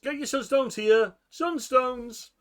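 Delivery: loud, high street hawker call; vendor calling out. Acted. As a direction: hawk your wares across the market. Market vendor calling out wares.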